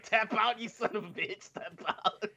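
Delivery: high, gloating "tap out" with a laugh; mock taunt. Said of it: "I'm like".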